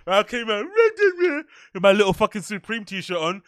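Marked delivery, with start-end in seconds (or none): 0.6-1.4 high, squeaky nervous stammer. Retold or imitated. Fan stammering in front of Harry, high and nervous. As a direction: trip over words, high and starstruck. Imitating his own nervous self.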